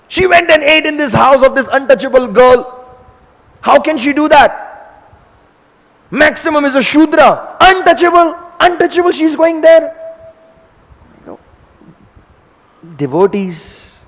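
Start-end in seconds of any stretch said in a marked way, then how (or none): 0.1-10.0 high, loud indignant tirade; outraged rant. Acted. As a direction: hammer home the scandal.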